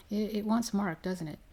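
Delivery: ordinary delivery, nothing marked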